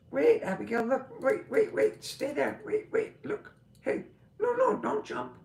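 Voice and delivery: high, loud calls to stop the dog; calling the dog. Real, not acted. Owner calling to dog Abigail not to jump, loud high calls. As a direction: call out sharply so she stays put.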